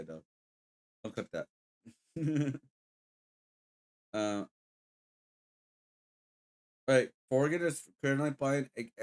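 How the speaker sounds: usual speaking voice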